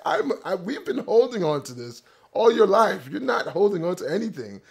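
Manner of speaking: mother's Jamaican voice through a laugh; mock-stern mom, chuckling; Jamaican accent, laughing. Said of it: "the accent that I'm trying to replicate".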